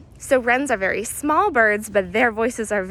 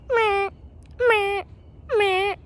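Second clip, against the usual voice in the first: high nasal-sounding bird squawk, much higher than her voice; blue jay call imitation; 11 semitones higher, much slower than usual. Guide imitates the distant blue jay for viewers, high squawk. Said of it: "that's a blue jay".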